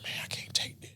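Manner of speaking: breathy whisper, barely there; whispered plea. Retold or imitated. Retelling what he said to God in the car, whispering. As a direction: let the words fall out in a broken whisper.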